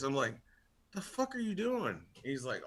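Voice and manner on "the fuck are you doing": incredulous. Retold or imitated. Disbelief at cousin's arrival.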